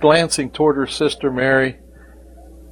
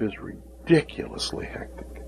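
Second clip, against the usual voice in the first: whisper; 2 semitones higher, 11 dB quieter than usual. Real, not acted.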